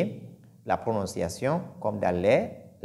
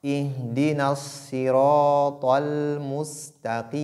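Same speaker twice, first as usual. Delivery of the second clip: Quran recitation; 3 semitones higher than usual. Shown as a demonstration. Teacher recites the verse for students. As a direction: intone the verse, stretching vowels. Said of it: "au milieu".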